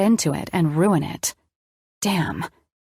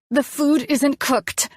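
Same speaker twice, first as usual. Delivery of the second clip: higher, slow, building anger; rising rage; 7 semitones higher, slower than usual. A narrator's reading. Elena telling Colin, slow and growing angry. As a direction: spell it out slowly through rising rage. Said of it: "my rage growing".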